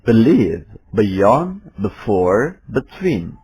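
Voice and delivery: American accent, "be" as a short schwa "buh"; American be- demo; American English. Shown as a demonstration. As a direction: say believe, before with "buh" American style. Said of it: "dengan gaya American English".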